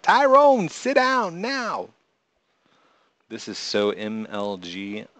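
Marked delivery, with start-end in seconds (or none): 0.0-1.9 very high, loud yell, slow on the last words; shouted order. Retold or imitated. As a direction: bellow it at Tyrone across the room. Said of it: "I thought you shouted out".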